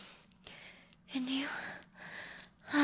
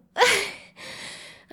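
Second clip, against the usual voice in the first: loud high relieved laugh; 11 semitones higher, 9 dB louder than usual. Acted. Lover laughing with relief at her partner, high laugh.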